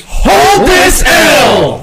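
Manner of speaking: slow, high, loud bellow; drawn-out shout. Acted. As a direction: stretch it out like a game-show host. Host's catchphrase, drawn-out shout.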